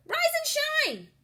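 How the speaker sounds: high, loud cheery shout; shouted wake-up call. A narrator's reading. Camilla shouting at a leaf to get up. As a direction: bellow a morning wake-up.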